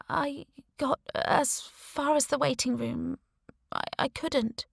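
hushed and halting, near tears; Sarah's voice. Acted. Sarah's tearful confession.

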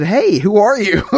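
surprised greeting. Retold or imitated. Confronting a stranger at the fire.

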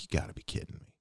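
whispered in disbelief; hushed you-gotta-be-kidding-me; disbelieving whisper. Retold or imitated. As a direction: breathe it out, stunned. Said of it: "And I was like".

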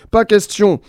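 sharp high exclamation; exclaiming. Acted. Exclamation Mark refuses outright, a high sharp cry. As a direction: snap it out emphatically. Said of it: "s'exclama Point d'exclamation".

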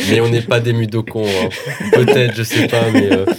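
laughing as he talks; amused, half laughing. Real, not acted.

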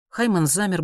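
ordinary delivery, nothing marked

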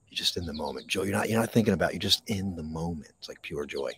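ordinary delivery

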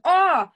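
high, loud mock cry of pain; a sharp retold "ah" of pain; imitated yelp of pain. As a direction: yelp like a dad hit on the nose. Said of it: "and he went".

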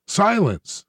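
a sudden high bellow to silence the room. Acted. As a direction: cut them off with one booming word. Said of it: "His bellow shook the room".

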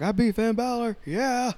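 high, cocky wrestler brag; boasting as L A Knight. Pretend. As L A Knight bragging about beating Finn Balor, high cocky boast. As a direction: crow it like a winner's promo. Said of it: "if I'm L A Knight".

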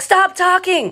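high-pitched mock yell; imitated scolding. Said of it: "She's like".

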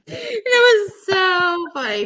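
high, slow, laughing wail; laugh-crying. Real, not acted. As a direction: laugh till you almost cry. Laughing about her cat.

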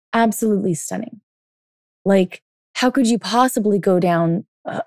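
normal talking voice

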